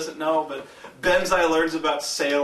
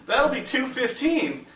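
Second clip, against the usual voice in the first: a hint of cashier, higher and slow; lightly voiced cashier; 7 semitones higher, slower than usual. Cashier tells customer the total, slow and higher. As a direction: lightly imitate a drawn-out checkout announcement.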